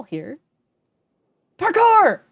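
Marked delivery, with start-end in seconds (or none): 1.6-2.2 loud high gleeful cry; excited shout. Real, not acted. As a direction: shout it like a triumphant leap. Vaulting over the wall.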